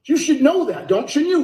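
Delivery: ordinary delivery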